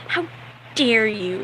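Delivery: mock offended teen-girl act; put-on bratty indignation; bratty teenage girl. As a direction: imitate a movie brat taking offense. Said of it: "bratty, stereotypical movie teenage girl".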